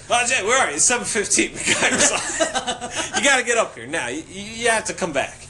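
loud, high, insistent roommate calling; urgent phone demand. Retold or imitated. Roommate's urgent phone call acted out.

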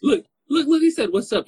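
high and loud, calling out; bubbling over with excitement; high excited call. Acted.

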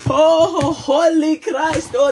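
put-on very high, loud girlish squeal of awe; mock swooning. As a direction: imitate a girl swooning in falsetto. Acting out girls' swooning reaction.

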